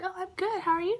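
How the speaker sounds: bright, chirpy customer-service voice, pitched well up; fake nice. Cashier to a customer at the counter, forced cheerful. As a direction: put on a sweet voice for a customer. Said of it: "be nice, right?".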